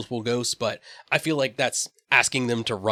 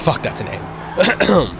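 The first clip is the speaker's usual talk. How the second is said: exasperated; 7 semitones higher, 4 dB louder, much slower than usual. Real, not acted. Exasperated at a long car name.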